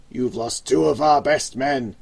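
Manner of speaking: loud, furious boss voice; the gang boss yells at his partner; angry yell. Acted. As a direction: rage at the old man. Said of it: "V stood up and yelled".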